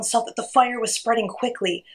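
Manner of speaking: ordinary delivery, nothing marked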